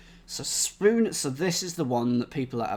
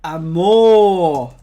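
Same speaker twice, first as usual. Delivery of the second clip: loud exclamation; 2 semitones higher, 14 dB louder than usual. Pretend. Joking Italian love exclamation.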